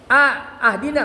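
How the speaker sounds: "ahdina" begun with a in place of i; wrong vowel. Shown as a demonstration. Teacher showing another forbidden reading, wrong a on "ahdina". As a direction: sound out "A", then open "ahdina" on a. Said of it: "nggak boleh juga".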